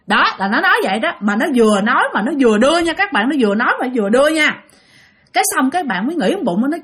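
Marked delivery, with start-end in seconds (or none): none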